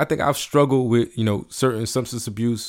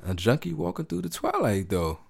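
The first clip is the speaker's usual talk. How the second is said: hurt [name] line; 5 semitones higher, 6 dB quieter than usual. Retold or imitated. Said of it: "I was like".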